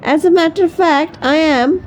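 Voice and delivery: the fox, smug and plotting; a hint of a sly fox's voice. A narrator's reading.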